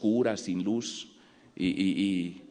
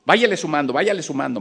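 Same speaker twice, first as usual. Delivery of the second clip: pushy cop; 5 semitones higher, 3 dB louder than usual. Retold or imitated. Policeman pressuring him to pay bribe.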